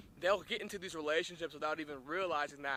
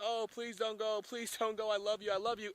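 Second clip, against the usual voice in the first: higher-pitched, lightly acted sobbing plea; mock crying plea; 6 semitones higher than usual. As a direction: imitate a wife begging her soldier to stay, a touch tearful. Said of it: "wives are crying".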